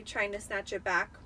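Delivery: ordinary delivery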